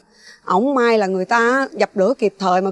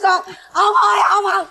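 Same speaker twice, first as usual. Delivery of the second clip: desperate pleading; 6 semitones higher than usual. Acted. Mother begging husband and son to stop, crying out. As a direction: call out desperately over the chaos.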